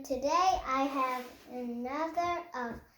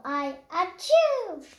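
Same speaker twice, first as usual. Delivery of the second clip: sneeze; 3 semitones higher, 4 dB louder than usual. Acted. Character sneezes mid-sentence.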